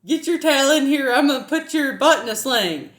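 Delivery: loud, high Southern twang, imitating grandparents; thick country Southern accent, hammed up; strong Southern accent. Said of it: "had such a strong Southern accent".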